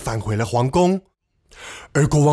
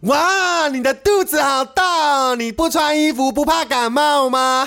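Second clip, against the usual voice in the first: put-on little boy crying out; 8 semitones higher than usual. A narrator's reading. Little boy who won't be hushed, crying out at the king. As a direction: call out high like a cheeky boy. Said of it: "还是不停的大叫说".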